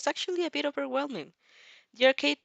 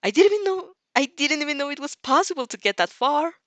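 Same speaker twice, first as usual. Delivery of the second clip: higher, louder amazed voice; marveling; 7 semitones higher, 7 dB louder than usual. Acted. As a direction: gape at the impossible score. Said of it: "You marvel".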